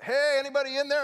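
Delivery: loud, high call into the tent; cop calling out. Retold or imitated. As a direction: shout to whoever is inside. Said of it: "the cop pulls up".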